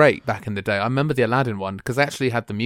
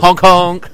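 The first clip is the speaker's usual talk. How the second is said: loud, higher honking horn noise; silly clown; 6 semitones higher, 12 dB louder than usual. Pretend. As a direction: honk like a goofy bus horn. Battle bus horn joke.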